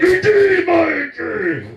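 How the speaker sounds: slow, high shouted threat; drawn-out high yell of a furious girlfriend; menacing shout. Acted. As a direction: bellow it breaking down the door. She breaks the door, shouts.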